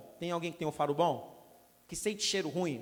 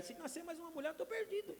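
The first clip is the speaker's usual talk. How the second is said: much higher, rising comic whine; squeaky half-joking panic; mock despair; 9 semitones higher, 9 dB quieter than usual. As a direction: wail in playful exasperation.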